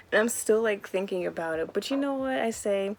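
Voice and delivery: usual speaking voice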